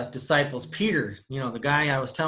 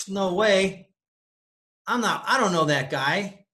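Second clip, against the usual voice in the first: higher, nervous mock denial; scared denial; 5 semitones higher than usual. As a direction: deny it quickly like you're caught. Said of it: "'cause he was scared".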